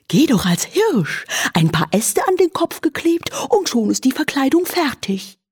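high owl voice; a wise old owl suggesting. Acted. (hohe Eulenstimme)

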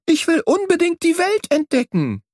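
high, eager insistence; excited call. A narrator's reading. Young bird pleads to join the swallows, excited. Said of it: "rief ich aufgeregt".

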